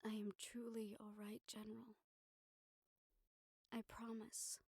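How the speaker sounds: soft whispered promise; hushed sincere reassurance; tender whisper. Acted. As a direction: whisper so he believes it. Final reassurance to the general.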